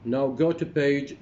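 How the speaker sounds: ordinary talk, nothing marked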